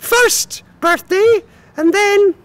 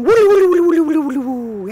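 time travel woo. Acted.

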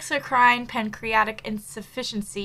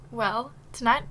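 usual speaking voice

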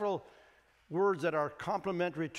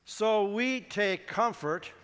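slow, raised, measured recitation; leading congregational reading; 4 semitones higher, 4 dB louder, much slower than usual. Acted. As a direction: lead the room word by word. Leads congregation reading aloud.

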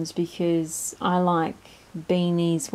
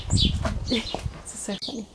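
ordinary delivery

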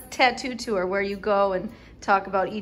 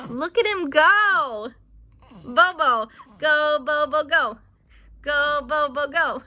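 root for him, louder; cheering; 5 semitones higher, 9 dB louder, slower than usual. Real, not acted. Mom cheering baby on tummy time.

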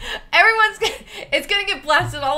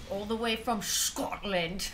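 badly faked Scottish accent, much softer; mock Scottish accent; 3 semitones lower, 10 dB quieter than usual. Streamer announcing where a chatter connects from, mock Scottish accent. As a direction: ham up a soft Scottish accent. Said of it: "It's my Scottish accent".

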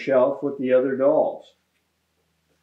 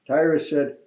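usual speaking voice

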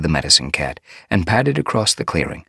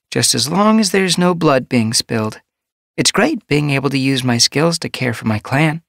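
higher, kindly healer voice; gentle medicine cat; 11 semitones higher, 5 dB louder than usual. A narrator's reading. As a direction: advise a pregnant queen kindly.

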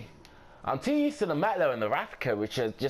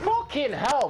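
high, loud startled swearing; startled outburst; 13 semitones higher, 5 dB louder, slower than usual. Real, not acted. Gamer ambushed mid-match, startled outburst. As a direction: blurt it out high and loud, caught off guard. Caught off guard in the game.

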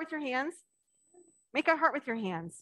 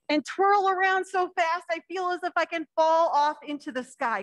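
put-on reading to children; 3 semitones higher, 3 dB louder than usual. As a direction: draw the little ones into the story. Reads picture book to children.

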